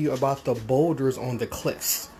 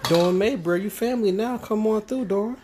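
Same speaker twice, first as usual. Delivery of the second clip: high, bright greeting to a viewer; excited welcome; 8 semitones higher than usual. Real, not acted.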